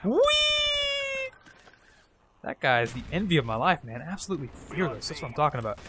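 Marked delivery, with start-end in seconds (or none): 0.1-1.3 high, drawn-out "whee"; gleeful whee. Pretend. Player whooping over his own gameplay, gleeful high whee. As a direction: let out one long high squeal.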